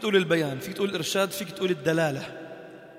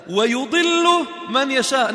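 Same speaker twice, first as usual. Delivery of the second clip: ويضل drawn out slowly, higher and louder; slow raised recital; 6 semitones higher, 5 dB louder, much slower than usual. Shown as a demonstration. (تلاوة بطيئة بصوت أعلى)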